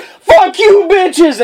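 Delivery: high, shouted taunt; acted gung-ho shout; defiant battle cry. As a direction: yell it as you open fire. Reenacting his machine gun attack.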